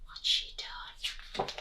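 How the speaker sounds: hushed, slow whisper. Real, not acted. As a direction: whisper it conspiratorially. Aside to children.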